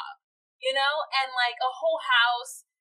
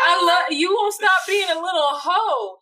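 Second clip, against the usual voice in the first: high, loud mock cry; mock wailing; 6 semitones higher, 6 dB louder than usual. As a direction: wail it like a sobbing girlfriend. Acting jealous girlfriend crying.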